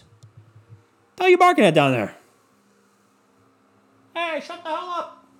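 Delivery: high sudden shout at a barking dog; yelling at the dog. Real, not acted. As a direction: snap at the dog out the window.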